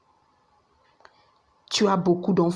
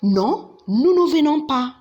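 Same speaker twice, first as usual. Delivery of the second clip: emphatic no; 6 semitones higher, 3 dB louder than usual. Shown as a demonstration. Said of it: "you could just say".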